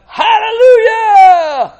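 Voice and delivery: very high, long, full-voiced shout; shouted hallelujah. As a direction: cry it out at the top of your voice. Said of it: "And again they shouted".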